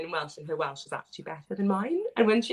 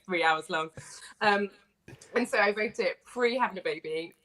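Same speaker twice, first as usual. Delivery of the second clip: usual speaking voice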